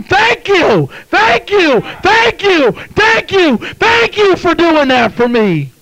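high, loud shouts of thanks; shouting praises. Acted. Freed debtor thanking the one who paid, shouting praises. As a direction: shout grateful praise, high and full-voiced. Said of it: "shouting praises to him".

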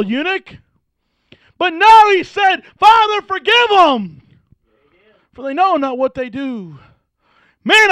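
high, loud, slowed preacher's cry; preacher crying out Jesus' words from the cross; full-force preaching. Acted. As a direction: ring out the forgiveness line.

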